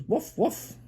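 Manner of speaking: high little "woof woof"; dog bark. Acted. Parent showing the dog in the book, dog bark. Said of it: "there's a dog that goes".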